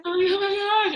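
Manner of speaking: high squeal of starstruck shock. Retold or imitated. Telling an interviewer about meeting [name], a high starstruck squeal. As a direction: squeak it out, overwhelmed.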